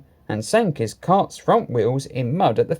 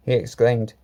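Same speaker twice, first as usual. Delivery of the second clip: plain reading voice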